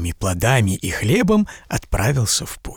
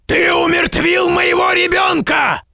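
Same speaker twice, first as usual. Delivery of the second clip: high shouted accusation; furious giant; 3 dB louder than usual. A narrator's reading. Giant accusing the merchant, furious high shout. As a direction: fling the charge at him.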